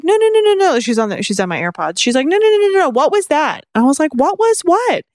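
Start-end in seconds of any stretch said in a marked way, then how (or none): 0.0-0.8 high, loud string of protests; frantic insistence. Retold or imitated. Sister's frantic insistence acted out. 2.3-3.6 high-pitched urgent protest; frantic insistence. Retold or imitated. Sister's frantic questioning acted out. 4.1-5.0 playing dumb.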